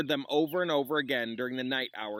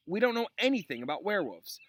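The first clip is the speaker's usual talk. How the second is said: lightly voiced whiny student; 7 semitones higher, faster than usual. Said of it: "explosion of complaints".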